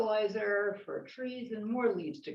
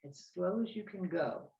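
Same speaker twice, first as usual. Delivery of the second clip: serene tai chi cues; 4 semitones lower than usual. Acted. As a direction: guide the movement gently.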